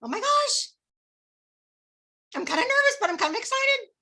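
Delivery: nervous-excited squeal. Shown as a demonstration. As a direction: gush it with excitement. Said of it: "You gotta get excited and say".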